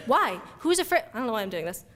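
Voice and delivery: ordinary delivery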